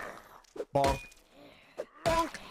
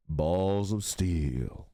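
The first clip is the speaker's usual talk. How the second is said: much lower, slow hero voice; deep macho catchphrase; 11 semitones lower, slower than usual. Retold or imitated. Quoting the game hero, slow deep macho voice. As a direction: drag it out like a tough guy.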